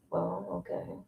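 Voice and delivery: usual speaking voice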